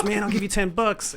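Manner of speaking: high-pitched mock of a pleading guy; imitated begging coworker. Retelling the coworker asking for weed, high-pitched imitation. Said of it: "That's basically how he sounded at the time".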